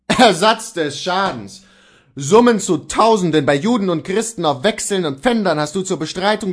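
loud, scornful outcry; angry exclamation. A narrator's reading. Luther attacking Kohlhaas' demand, loud and scornful. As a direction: throw his debts in his face. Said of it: "Luther rief".

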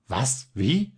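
sudden sharp rising Wie; bewildered outcry. A narrator's reading. Balthasar baffled at the guests, startled outcry. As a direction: cry out in confusion. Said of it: "rief Balthasar".

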